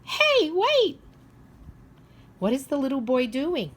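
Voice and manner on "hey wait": very high, loud call after someone. Acted. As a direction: shout after him to wait. Character calling after the man.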